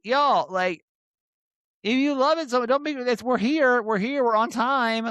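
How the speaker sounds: hammed-up cry to the fans that you're here; excited. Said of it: "I was like".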